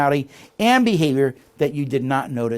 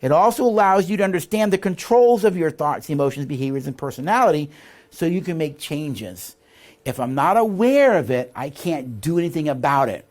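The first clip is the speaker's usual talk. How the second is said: reading aloud; 2 semitones higher, 4 dB louder than usual. Shown as a demonstration. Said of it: "So, it says here".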